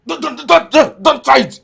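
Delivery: high, loud stammered protest; agitated, repeated yelled command; outraged warning. Acted. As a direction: splutter the warning, voice shooting up.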